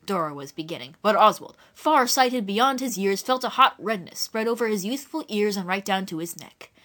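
ordinary delivery

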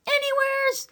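very high, wild exclamation; wild country woman. A narrator's reading. Eliza asked where to put Lily, wild high reply. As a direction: blurt it wildly, high up. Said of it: "said Eliza wildly".

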